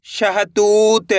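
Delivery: word stretched very slowly; drawn-out reading. Shown as a demonstration. Teacher reading a word for learners, drawn out. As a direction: read it out with long held vowels. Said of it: "एक अक्षर का उच्चारण करना है".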